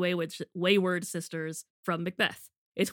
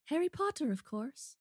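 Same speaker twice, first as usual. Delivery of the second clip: put-on much higher, softer boy voice; Harry Potter impression; 7 semitones higher, 8 dB quieter than usual. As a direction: voice young Harry lightly. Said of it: "I'll do an impression".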